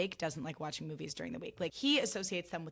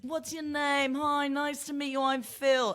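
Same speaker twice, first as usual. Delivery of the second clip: friendly celebrity; 8 semitones higher, 6 dB louder, slower than usual. Retold or imitated. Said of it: "He was like".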